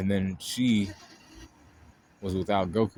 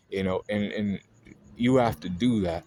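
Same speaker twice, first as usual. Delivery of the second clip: ordinary delivery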